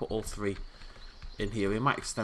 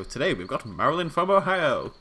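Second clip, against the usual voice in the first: louder, higher host call, OHIO stretched; game show host; 6 semitones higher, 7 dB louder than usual. Pretend. Player re-announces the guest as a game show host. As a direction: sell Ohio like a prize winner. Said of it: "Sounds like I'm presenting a game show".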